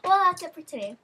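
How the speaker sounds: usual speaking voice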